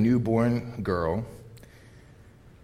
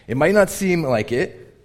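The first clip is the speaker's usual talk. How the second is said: ordinary talk, nothing marked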